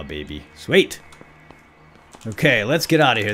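normal talking voice

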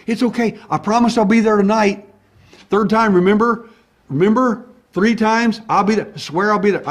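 high, loud, urgent reassurance; loud re-enacted pleading assurance; insistent promise. Acting his insistent reassurance to her.

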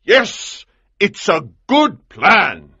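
loud, high, emphatic approval; excited cry. A narrator's reading. Colonel clapping at his own plan, excited cry. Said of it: "he cried".

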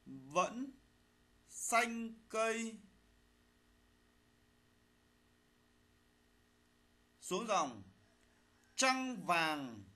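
slow dictation, phrase by phrase; dictating. Shown as a demonstration. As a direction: read it out slowly for pupils to write. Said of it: "lắng nghe thầy đọc bài, chúng ta soát lỗi".

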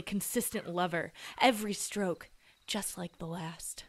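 low breathy whisper. Acted. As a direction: whisper it like a secret. Seductive whisper about lover.

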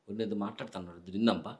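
low, secretive near-whisper; whispered aside. Acted. Husband invites wife to listen in on the others, whispering low. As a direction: drop right down so they won't hear. Couple sneaking off to eavesdrop.

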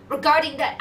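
loud, searching try at a character voice; character voice attempt. Streamer hunting for his game character's voice, loud role voice. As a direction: punch it out loudly as the game character. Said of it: "I need to find his voice".

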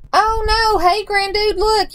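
high, urgent storybook call; alarmed yell. A narrator's reading. Lucy spotting sliding snow through telescope, high alarmed yell. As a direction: raise the pitch to sound the alarm, without shouting. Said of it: "yelled Lucy".

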